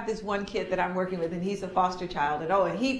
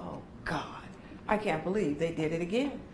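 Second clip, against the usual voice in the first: soft, low, worn-out groan to yourself; exasperated sigh; 4 semitones lower, 8 dB quieter than usual. Retold or imitated. Adult calming down after a kid's trick, quiet and weary. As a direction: mutter it after you calm down. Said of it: "you go".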